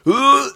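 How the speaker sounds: loud high heaving yelp; imitated retching. Retelling vomiting into the toilet, loud high retch. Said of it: "violently projectile vomiting".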